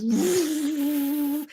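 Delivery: long imitated whoosh of unrolling; scroll unrolling noise. Shown as a demonstration. Showing the endless list unrolling, long vocal whoosh. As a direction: draw out a steady unfurling noise. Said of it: "and the scroll goes".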